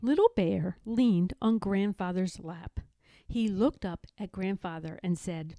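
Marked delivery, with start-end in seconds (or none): none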